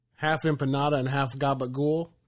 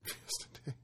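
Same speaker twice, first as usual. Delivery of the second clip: whispered; 19 dB quieter than usual. Acted. Echoing the name breathily.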